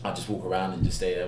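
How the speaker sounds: ordinary delivery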